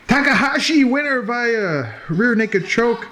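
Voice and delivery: high, slow winner announcement; ring announcer. Acted. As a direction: announce the winner, drawing out the name.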